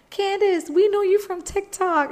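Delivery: voicing the thrilled staff who know her from TikTok, pitched well up; excited fan greeting. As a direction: squeal it like a fan spotting a star. Airline staff recognise her from TikTok.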